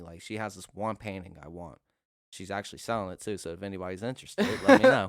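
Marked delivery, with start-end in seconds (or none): 4.7-5.1 high, loud squeal; excited. Real, not acted. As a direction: blurt it out excitedly. Sudden loud squeal selling painting.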